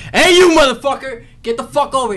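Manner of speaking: loud, high-pitched barked order; abusive Marine yell. Shown as a demonstration. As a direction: demonstrate a Marine screaming someone over. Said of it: "how we talk in the Marine Corps".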